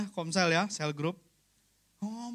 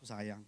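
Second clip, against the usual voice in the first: "Sayang" said on one flat low note, much softer; one-tone answer; 9 semitones lower, 13 dB quieter than usual. Shown as a demonstration. Husband answering his wife's question with "Sayang" on one level note. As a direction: give "Sayang" one level tone, no rise or fall. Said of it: "Nadanya satu nada aja".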